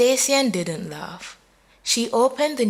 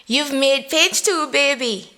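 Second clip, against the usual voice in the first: high, loud gleeful tease; teasing call; 7 semitones higher, 5 dB louder than usual. A narrator's reading. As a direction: crow the news at her friend. Friend teasing on phone.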